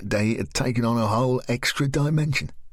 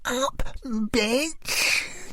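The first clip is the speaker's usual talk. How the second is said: much higher, unhinged yell at Lisa; higher, savage outburst; bellowed; much slower than usual. Acted. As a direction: lash out at her. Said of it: "Katrina bellowed at Lisa".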